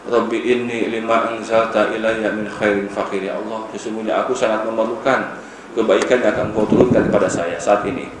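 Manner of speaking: low, soft, earnest prayer voice. Acted. Musa praying alone after helping the women, low and soft. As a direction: plead quietly to God.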